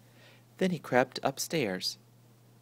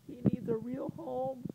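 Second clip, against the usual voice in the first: put-on high, pleading little girl; girl's high plea, much higher; pleading cry; 13 semitones higher, 4 dB quieter than usual. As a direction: beg like a small child. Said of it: "cried Alice Jane".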